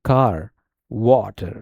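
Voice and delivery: normal talking voice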